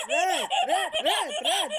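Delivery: high sing-song calling. Real, not acted. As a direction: call the name teasingly. Calling out for Rad. (نداء بصوت عالي)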